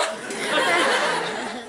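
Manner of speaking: loud high laughing Southern twang; laughing Southern y'all. Shown as a demonstration. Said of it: "Southern accents really help".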